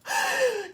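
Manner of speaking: high, hammed-up theatrical gasp; mock dramatic gasp. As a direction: gasp like a wounded diva. Acting Sharon's dramatic reaction.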